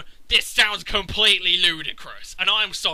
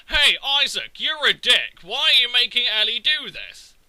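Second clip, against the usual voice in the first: louder, a touch higher, hammed-up accusing; mock annoying guy; 4 semitones higher, 7 dB louder than usual. The guy berating Isaac, a mock accusing call. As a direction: snipe at Isaac like a whiny sidekick. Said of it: "will randomly go".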